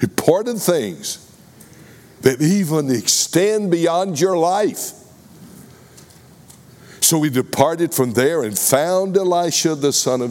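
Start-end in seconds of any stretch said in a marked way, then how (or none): 0.0-4.9 raised and slowed for emphasis; emphatic preaching. Acted. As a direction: drive home the importance. Preacher stresses Elijah's mission.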